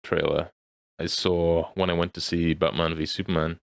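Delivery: normal talking voice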